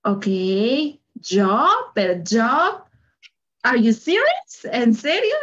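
puzzled, questioning rises on each phrase; incredulidad actuada. Retold or imitated. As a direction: play mock disbelief, pitch climbing into the questions. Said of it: "Y yo me quedo como". (incrédula, con tono de pregunta)

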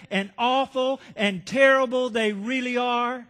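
slow, loud, building hateful rant at God; furious outburst. Retold or imitated. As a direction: pound each word, growing to a full shout. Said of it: "You ever got mad like that at God".

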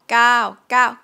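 gao with a long vowel; Thai word gao. Shown as a demonstration. Teacher modelling the long gao for students. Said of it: "So the an vowel band".

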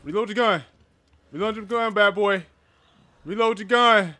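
hurried loud urging; urgent shouting. Real, not acted. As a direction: bark it like the monster is on you. Panicked self-urging during fight.

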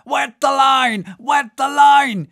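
high, loud repeated shout; Stubb. A narrator's reading. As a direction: cry an urgent order to the oarsman. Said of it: "cried Stubb to the top oarsman".

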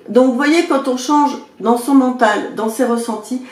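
ordinary talk

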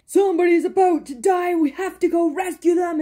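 lightly mocked urgent rescue call; mock urgent anime line. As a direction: mimic a dramatic anime hero, lightly. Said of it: "anime is really bad for this".

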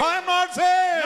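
very high, loud shout; emphatic pulpit shout; full-force preaching. Real, not acted. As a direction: cry it out to the congregation.

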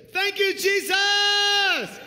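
long, high, loud drawn-out cry; a shouted mocking cry of praise; mock shouted thanks. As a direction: ham up the congregation's cry. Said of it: "Y'all are just saying".

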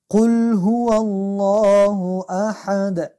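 slow chanted قل هو الله احد with a full, heavy الله; slow recitation. Shown as a demonstration. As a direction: recite loudly and drawn out. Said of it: "اشتباه بسیار کلانه".

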